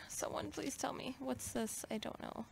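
unvoiced, pleading whisper. Real, not acted. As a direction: whisper for help, half to yourself. Whispers while lost in game.

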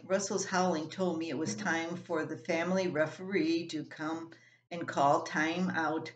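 ordinary delivery

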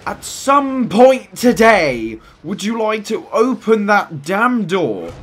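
high, loud and exasperated; impatient yelling. Real, not acted. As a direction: shout at the slow door, fed up. Impatient at slow door.

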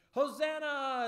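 loud, high festive cry; shouted Hosanna. Retold or imitated. Children's leader voicing the crowd's loud cry. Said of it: "they were shouting".

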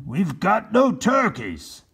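high, slow troubled voice; dismayed governor. Acted. Governor questioning Captain Bill, dismayed. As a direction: fret over the lost feast. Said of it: "said the governor".

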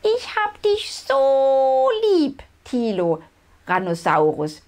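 put-on tiny squeaky baby dino voice; high squeak. A narrator's reading. Little sister squeaking love to big brother, high squeak. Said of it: "piepst Tinchen".

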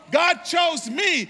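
high, loud and slow, each word hammered; shouted proclamation. Acted. Pastor declares it to the whole church, shouting slowly. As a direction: shout it over the crowd. Repeated climax line.

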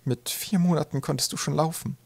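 proud, throaty mother. A narrator's reading. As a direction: tell it proudly, high in the throat. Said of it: "wie stolz sie darauf war". (stolze, hohe, kehlige Mutterstimme)

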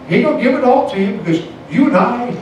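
high, emphatic appeal to the church; urgent preacher's cry; rising preaching. Real, not acted. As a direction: climb in pitch as you press the point. Preaching rises.

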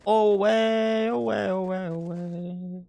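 drawn-out "away", fading out; playful self-made echo trailing off. Pretend. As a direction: mimic an echo dying away.

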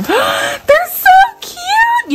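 high, slow squeal after a gasp. Real, not acted. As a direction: gasp then squeal over how cute. Gasp at the products.

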